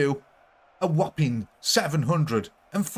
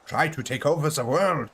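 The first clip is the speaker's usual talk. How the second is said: put-on German accent; 2 semitones higher than usual. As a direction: proclaim world domination in a hammed-up German accent. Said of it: "why he came out German then".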